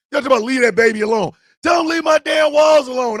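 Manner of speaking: mock-angry rant. Real, not acted. Owner ranting to viewers about his cat, loud. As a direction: vent loudly, half joking.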